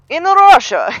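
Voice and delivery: high, loud hammed-up Russian accent. Joking about his accent attempts, in a loud Russian accent. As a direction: belt it out in a mock Russian accent. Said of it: "I like to do the Russian accent".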